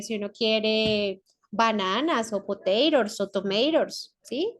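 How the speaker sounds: ordinary talk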